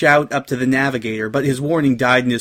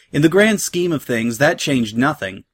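ordinary delivery

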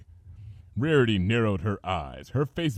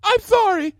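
crying; 8 dB louder than usual. Acted. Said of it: "she cried".

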